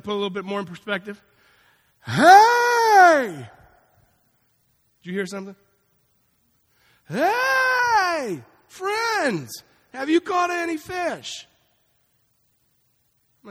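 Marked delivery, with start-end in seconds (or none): 2.0-3.5 very high, loud long shout across the water; calling out from afar. Retold or imitated. Jesus shouting to the disciples out on the boat, calling out. 7.1-11.5 high, loud, drawn-out hail; calling out across water. Retold or imitated. Jesus calling to the fishermen from shore, loud hail.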